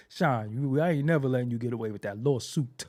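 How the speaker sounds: usual speaking voice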